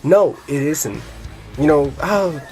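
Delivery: usual speaking voice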